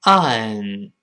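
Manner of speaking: ON drawn out, tongue root held still; model pronunciation. Shown as a demonstration. Teacher modelling on for the class, drawn-out model pronunciation. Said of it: "注意舌根不能动啊".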